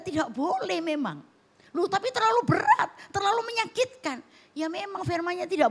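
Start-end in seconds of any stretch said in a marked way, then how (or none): 1.7-4.2 high, protesting complaint voice; whiny objection. Pretend. Congregant voiced protesting that forgiving is too hard. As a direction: raise it up like a hurt listener objecting. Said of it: "imagined objection from congregation".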